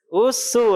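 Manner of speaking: Usu kept thin and light. Shown as a demonstration. Teacher contrasts thin sin.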